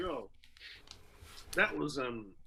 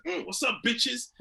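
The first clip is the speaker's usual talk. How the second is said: cocky greeting; 9 semitones higher, slower than usual. Retold or imitated. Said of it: "I said".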